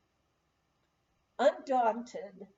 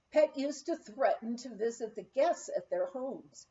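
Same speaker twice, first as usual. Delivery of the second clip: plain reading voice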